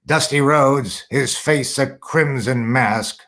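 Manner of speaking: slow, lightly voiced announcer call; a hint of a low smoky announcer; Gordon Solie impression. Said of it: "he had that nice low kind of smoky tone".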